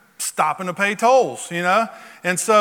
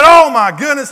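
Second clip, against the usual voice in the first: loud, higher oh my goodness; a retold gasp of amazement, much louder; amazed exclamation; 6 semitones higher, 11 dB louder than usual. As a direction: burst out in wonder. Man's amazed reaction retold.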